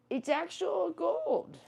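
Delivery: high, slow, amazed; excited. Real, not acted. Showing viewers the gold necklace, high amazed. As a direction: stretch it out, amazed.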